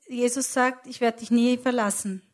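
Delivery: ordinary talk, nothing marked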